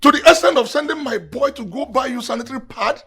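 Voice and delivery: high, loud, outraged accusation; jealous tirade. Acted. Husband accusing his wife over the errand, jealous tirade.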